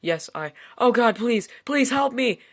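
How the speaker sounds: opbouwend van gejaagd naar een hoge, luide noodkreet; desperate cry for help. Acted. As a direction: veel hoger en luider smeken om hulp. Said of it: "I could hear sobbing".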